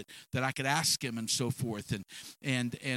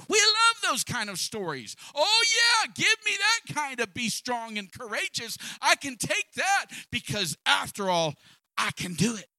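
much higher and louder, excited preaching cry; rising shout; 8 semitones higher, 4 dB louder than usual. Real, not acted. Preacher rousing the congregation with a high excited shout. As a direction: whip the crowd up.

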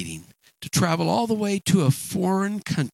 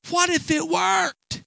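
urgent shout; 12 semitones higher, slower than usual. Real, not acted. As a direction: call it out to the whole room. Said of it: "preacher urges the congregation".

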